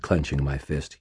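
plain reading voice

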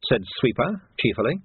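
usual speaking voice

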